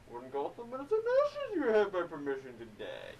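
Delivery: hammed-up masked villain impression; Bane. Bane staring down Batman, mock villain voice. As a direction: muffle it like an exaggerated masked villain. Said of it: "and he's talking".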